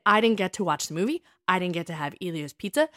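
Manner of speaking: normal talking voice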